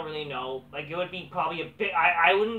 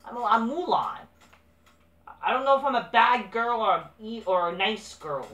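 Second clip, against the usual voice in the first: mock confused wrestler, higher and louder; mocking impression; 5 semitones higher, 8 dB louder than usual. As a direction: parody her as dim and clueless, higher and louder. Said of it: "This is just fake, like".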